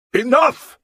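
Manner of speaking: high, loud command; king halting a brawl; shout. Acted. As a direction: bark it to stop the fight. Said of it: "Alfred shouted".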